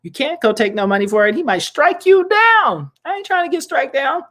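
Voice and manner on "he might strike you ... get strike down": playful high-pitched dread of being struck down; mock dramatic warning. Dramatic warning about God's wrath.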